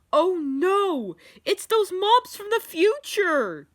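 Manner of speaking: high, loud cry of alarm; alarmed yell. A narrator's reading. Sonic warns of mobs from the future, yelling. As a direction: yell a panicked warning. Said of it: "yelled Sonic".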